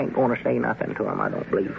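quick, hushed reply. Acted. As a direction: answer under your breath. Hushing as Squire approaches.

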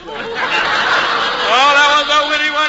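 high, slow, laughing self-praise; laughing at own joke. Acted. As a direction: chuckle over your own wit.

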